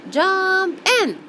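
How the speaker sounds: high, loud rallying call; crying out. Acted. Ants call the bee into the water, crying out. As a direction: shout it eagerly, first word stretched. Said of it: "they cried".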